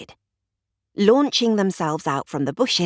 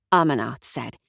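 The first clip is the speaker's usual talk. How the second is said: ordinary delivery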